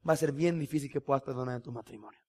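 usual speaking voice

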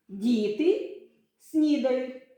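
"Діти снідають" slowly, with a clear pause between the words. Shown as a demonstration. Teacher shows two words in a sentence, word by word. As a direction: separate "Діти" from "снідають", each word drawn out.

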